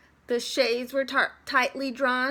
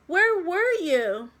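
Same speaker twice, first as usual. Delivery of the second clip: put-on kids; 7 semitones higher, 5 dB louder than usual. A narrator's reading. Kids call out to teacher.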